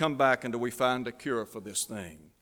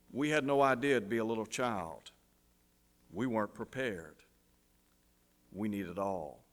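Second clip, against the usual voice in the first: subdued, falling away softer and lower; grave old doctor; 3 semitones lower, 5 dB quieter than usual. A narrator's reading. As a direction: break the grim news quietly, with long pauses. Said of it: "the old doctor's smile fades and he says".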